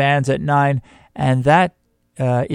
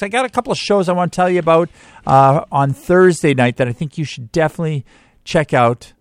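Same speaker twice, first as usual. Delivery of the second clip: usual speaking voice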